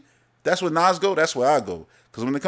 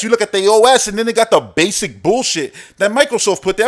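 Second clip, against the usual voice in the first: ordinary delivery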